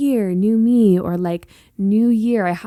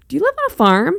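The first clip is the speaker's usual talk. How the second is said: higher, mock clueless question; naive Californian; 9 semitones higher, 3 dB louder, much faster than usual. Californian locals asking her about farms, mock naive. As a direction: ask like a wide-eyed outsider, teasing. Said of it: "they're like".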